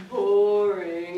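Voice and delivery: low, drawn-out mock boredom; retold boredom, dropped in pitch. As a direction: voice the tedium, low and heavy. Acting out the painter's dull childhood.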